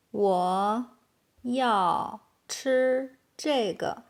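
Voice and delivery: slow, separated words. Shown as a demonstration. Teacher giving learners the translation, slow and word by word. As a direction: leave a gap after each word. Slow model, then natural take.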